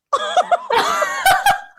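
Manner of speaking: squealing laugh, very high; high embarrassed shriek of laughter. Real, not acted. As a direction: burst into a high helpless laugh. Embarrassed at own mistake.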